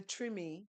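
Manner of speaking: Treme said TRIH-mee; local pronunciation. Shown as a demonstration. Local pronunciation, explained afterward.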